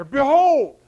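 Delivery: loud, high drawn-out shout; yell. Acted. Preacher yelling out John's powerful word, loud shout. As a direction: yell it out like a proclamation. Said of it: "word that John yells out there".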